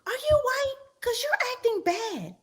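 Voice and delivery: high-pitched imitation of the aunt; mock the aunt scolding the kid, voice up high. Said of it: "She literally goes".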